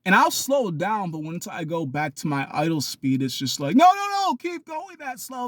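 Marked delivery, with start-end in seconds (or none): 3.8-5.5 very high-pitched mimicking protest; mock objection. Mimics people objecting to speed.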